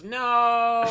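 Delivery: high, loud mock robot cry. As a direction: shout it like a hurt robot. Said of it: "the robot goes".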